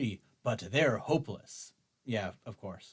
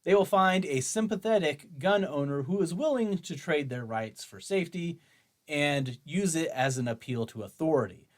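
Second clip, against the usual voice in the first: normal talking voice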